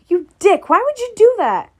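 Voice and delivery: high, loud outraged yell; angry yell. Acted. Character yells at Jeff.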